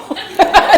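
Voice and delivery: a high, loud burst of laughter. Real, not acted. Recalling the guest's shy request, a loud laugh. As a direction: laugh out big.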